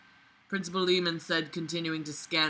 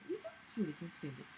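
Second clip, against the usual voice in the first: pet talk; 2 semitones lower, 17 dB quieter, much faster than usual. Real, not acted. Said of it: "doesn't everybody say stupid shit to their pets?".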